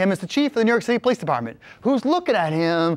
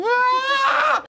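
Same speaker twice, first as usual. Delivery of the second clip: sharp high yelp; sudden high yelp of surprise; startled cry. Retold or imitated. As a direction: cry out mid-fall. Father falls into the cake.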